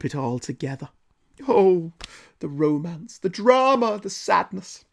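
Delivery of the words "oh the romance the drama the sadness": higher-pitched, theatrical mock swoon; mock melodrama. Reviewer mocking Gwen's heartbreak, mock-melodramatic. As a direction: sigh it out like an overwrought soap fan.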